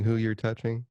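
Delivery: usual speaking voice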